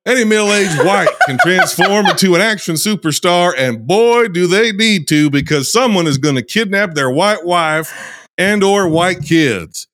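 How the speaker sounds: put-on lively Southern-accented storyteller reading an intro. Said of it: "intro here in this accent".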